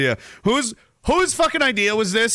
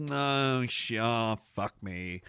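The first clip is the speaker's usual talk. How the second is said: dismayed mock groan fading out; 10 semitones lower, 10 dB quieter, much slower than usual. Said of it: "then it starts to become like".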